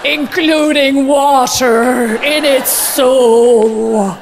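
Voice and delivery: mock poet, very slow ponderous cadence; hammed-up, drawn-out grand oration; Maya Angelou. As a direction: stretch every word out hugely, as solemn recitation. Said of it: "the cadence drives me insane".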